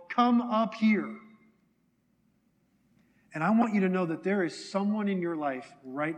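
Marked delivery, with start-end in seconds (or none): 0.1-1.2 slow, raised call, pitched higher; old sage calling down to the valley, slow and raised; shouting down from the mountain. Acted. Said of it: "he's shouting down to the valley below".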